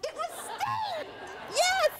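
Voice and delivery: very high, loud triumphant cry; thrilled shout. Real, not acted. Telling the audience it was [name], shrieking with joy. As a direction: scream it out in delight.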